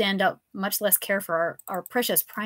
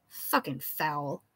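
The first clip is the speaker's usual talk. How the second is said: disgusted; 5 semitones lower, 5 dB quieter, much slower than usual. Acted. Disgust at the vomit.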